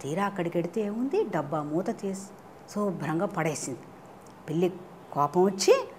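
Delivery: usual speaking voice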